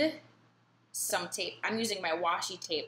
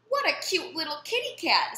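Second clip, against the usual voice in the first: lady cooing; 8 semitones higher, 4 dB louder than usual. A narrator's reading. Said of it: "said the lady".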